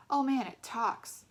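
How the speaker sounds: slow jeering yell; mock scream. A narrator's reading. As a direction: jeer loudly, stretching it out. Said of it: "screamed Eddie".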